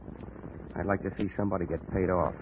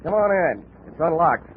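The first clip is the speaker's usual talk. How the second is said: calling out; 6 semitones higher, 4 dB louder, slower than usual. Acted. Calling to killers at door.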